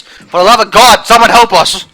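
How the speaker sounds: loud, high pleading cry for help; mock despair cry. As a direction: wail it like a hammy victim. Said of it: "this is what I say".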